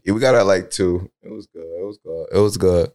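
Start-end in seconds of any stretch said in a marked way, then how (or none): 1.2-2.3 fading to near silence. Real, not acted. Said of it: "fading repeats".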